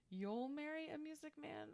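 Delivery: high, soft and even; co-host quoting the lyric she missed, high and soft; correct lyric. Shown as a demonstration. High-pitched, soft reading of the lyric. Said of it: "And I didn't hear".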